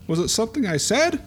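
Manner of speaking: a touch of fear as he's pushed out; mildly frightened. Acted. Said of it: "he's looking very frightened and going".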